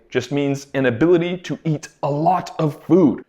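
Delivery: ordinary talk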